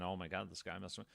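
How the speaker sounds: normal talking voice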